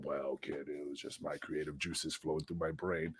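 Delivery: hushed impression of a smug promoter; softly mocking the promoter who named him; imitating the promoter. Promoter quoted in a wrestling story.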